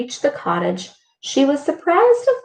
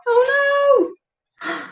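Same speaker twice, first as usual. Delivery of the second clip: high dismayed oh no. Real, not acted. Reader's dismay.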